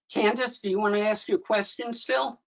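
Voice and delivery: ordinary delivery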